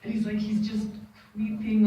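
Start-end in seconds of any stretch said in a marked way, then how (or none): none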